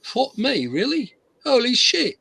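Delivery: high-pitched British astonishment; mock shocked reaction, voice lifted high; stunned English guy. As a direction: imitate a floored Englishman. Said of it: "he's like".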